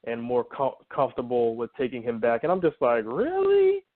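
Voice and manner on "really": high-pitched disbelieving "Really?"; drawn-up, sceptical reaction; incredulous. Retold or imitated. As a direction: question it in utter disbelief, voice shooting way up. Acting out her incredulous reaction.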